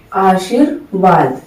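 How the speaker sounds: आशीर्वाद said slowly and softly, r leaning onto व; quiet careful आशीर्वाद; slow model word. Shown as a demonstration. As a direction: draw out आशीर्वाद, aa-sheer-vaad. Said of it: "जैसे ये रेफ श पे आ रहा है".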